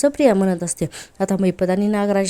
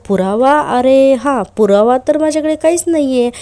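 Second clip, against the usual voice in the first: mock panic; 5 semitones higher, 6 dB louder than usual. Accused husband admits no proof.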